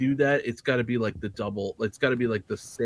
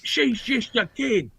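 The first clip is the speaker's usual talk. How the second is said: mock Australian accent; 10 semitones higher than usual. Friend imitating a cartoon hero in a bad Australian accent. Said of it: "I don't do a good Australian accent".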